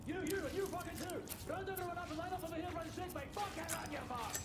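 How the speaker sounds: shouting orders. Acted. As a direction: bark the orders over the noise. Medic shouts orders in chaos.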